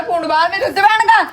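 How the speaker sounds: high, tearful and loud; pleading through tears; crying. Real, not acted. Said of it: "ਰੋਂਦੀ ਕਿਉਂ ਪਈ ਆ?".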